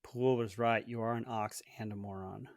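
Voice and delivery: quiet echo. Shown as a demonstration. Guest repeats quietly.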